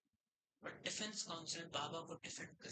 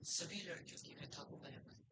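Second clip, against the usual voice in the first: hushed; 5 dB quieter than usual. Acted. Lawyer softens about the girl.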